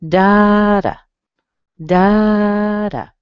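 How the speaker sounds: "Da, da," loud and high on the first, lighter on the second; strong-weak rhythm. Shown as a demonstration. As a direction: tap out a strong-weak beat. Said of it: "strong and then weak".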